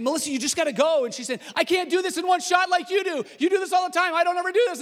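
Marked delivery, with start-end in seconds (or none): 0.0-1.0 loud, high, fast snapping at wife; frustrated husband shouting at his wife on set; exasperated shout. Retold or imitated. Arguing with wife on camera. 1.5-4.8 high, loud, frustrated outburst; wife shouting back at her husband mid-argument; upset protest. Retold or imitated. Wife's retort during argument.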